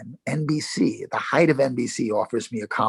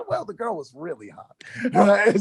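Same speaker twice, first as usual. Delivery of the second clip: laughing self-justification, words riding on the giggle; amused laughing; 9 semitones higher, 3 dB louder than usual. Real, not acted. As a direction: say it through laughter.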